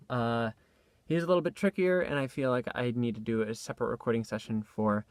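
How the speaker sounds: usual speaking voice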